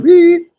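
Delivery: high, loud mock bleep sound; censor beep. Imitating the TV censoring a relative's name with a beep. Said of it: "they were blurring it out".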